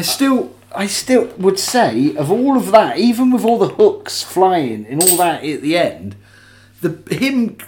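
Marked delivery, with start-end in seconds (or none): none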